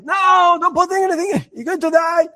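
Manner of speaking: high-pitched retold father's scream; panicked scream. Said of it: "and he screams".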